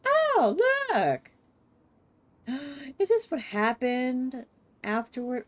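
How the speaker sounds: surprised squeal. Real, not acted. Sudden excited exclamation.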